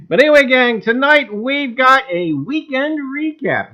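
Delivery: announcer voice. Acted. Host announcing the weekend recap to viewers, showman style. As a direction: present it like a broadcast headline.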